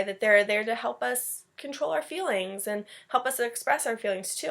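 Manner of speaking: ordinary delivery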